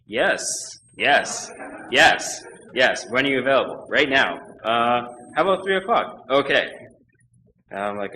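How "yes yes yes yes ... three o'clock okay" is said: loud, punchy re-enacted job call; eager acceptance. Applicant answering an interview call, loud and eager. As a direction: jump at the offer in emphatic bursts.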